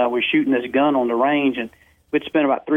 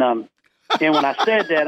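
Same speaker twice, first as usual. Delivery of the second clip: ordinary delivery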